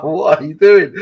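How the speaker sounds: high, loud disbelieving cry; incredulous. Retold or imitated. Friend texting beer photo at dawn, incredulous loud cry. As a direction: shout it in amused disbelief. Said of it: "I'm like".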